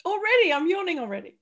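high, loud, quick exclamation; surprised at herself. Real, not acted. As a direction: exclaim it in disbelief, pitch jumping up. Catches herself yawning so early.

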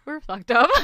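said through real laughter; cracking up mid-sentence; laughing. Real, not acted. Group cracking up at the story.

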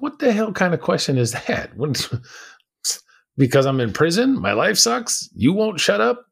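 high-pitched mock outrage, touched with a laugh; indignant retort. Angry inmate answers why he is so angry, indignant retort. Retold angry reaction to the question.

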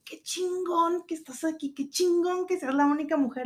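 high, admiring voice; admiring woman. Retold or imitated. Woman praising the only female chef, high admiring voice. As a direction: praise her like a starstruck fan. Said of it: "pero ella así como que".

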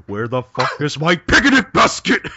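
high, loud comic bear voice; hammed-up bear complaint; cartoon bear. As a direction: demand it as an outraged cartoon bear.

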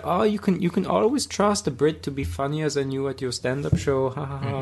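put-on host teasing the crowd, in a light Austrian accent. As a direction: imitate the Austrian host joking about Brits. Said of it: "he said in his Austrian accent".

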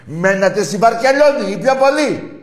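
enraged bellowing taunt; furious shout. Real, not acted. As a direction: scream the insults at rival fans. Ranting abuse at rival fans.

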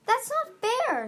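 high, loud, drawn-out protest; crying out. A narrator's reading. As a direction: cry out at the unfairness. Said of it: "Starflight cried".

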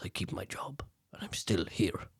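fearful whisper. Retold or imitated. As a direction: whisper it like he's afraid to be overheard. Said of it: "And then Vasil's just like".

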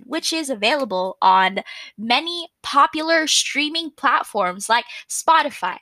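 ordinary delivery

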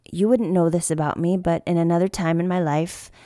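normal talking voice

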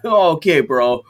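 imitating a thick Boston accent; lightly mocked Boston accent. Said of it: "such a thick Boston accent".

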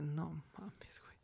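breathless whispered no mames; hushed stunned whisper; shocked whisper. Retold or imitated. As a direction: whisper it in disbelief, barely voiced. Scared reaction to child's story.

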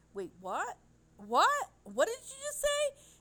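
a retold double take, climbing to a squeak of disbelief; mock-shocked what, rising to a high squeal; stunned disbelief. Retelling her shock at boyfriend's remark.

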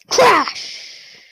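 high, loud burst of a crash; imitated thunder crash. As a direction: cry out the thunderclap, sudden and explosive.

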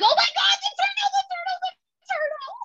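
very high, crying-laugh squeal; excited squeal. Retold or imitated. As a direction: squeal with teary delight. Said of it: "And I was like".

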